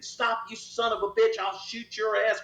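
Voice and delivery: high chasing shout; yelling at suspect. Retold or imitated. Cop yelling at fleeing suspect, high shout. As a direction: yell it mid-chase. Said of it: "and I'm saying".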